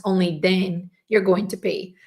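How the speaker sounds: ordinary talk, nothing marked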